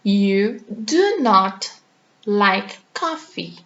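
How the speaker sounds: slow, do and not kept apart; careful slowed teacher demo; slow model sentence. Shown as a demonstration. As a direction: separate every word for learners. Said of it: "Se você não gosta de café".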